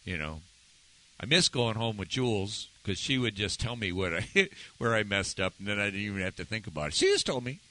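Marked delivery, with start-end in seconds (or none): none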